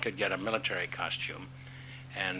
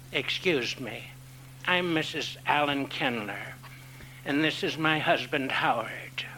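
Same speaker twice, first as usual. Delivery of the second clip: put-on a higher, slow, breathy old lady's voice, fading to a whisper; imitating an elderly lady; 6 semitones higher, slower than usual. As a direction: mimic a polite older woman from Indiana, slowly. Elderly Indiana wife introduces herself.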